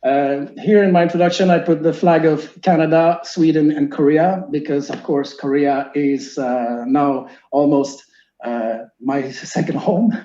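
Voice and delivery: normal talking voice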